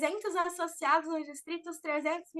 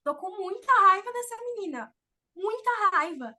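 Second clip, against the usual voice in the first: put-on higher and louder, showing real anger at the girl; angry demo; 5 semitones higher, 6 dB louder than usual. Shown as a demonstration. Demonstrating to the audience how anger sounds, angry. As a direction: let the anger out on raiva, louder. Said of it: "Olha o jeito que eu falei".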